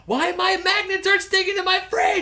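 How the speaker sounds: high, loud hammed-up screech; frantic yelling. Imitating a mother laying down the law, frantic yell. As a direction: yell it as if finally snapping. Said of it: "she was yelling at someone".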